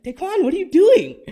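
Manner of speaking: high, loud, startled cry through a laugh; laughing surprised shout at the boy; startled outburst. Retold or imitated. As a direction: wake up with a jolt and call the kid's name. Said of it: "And I was like".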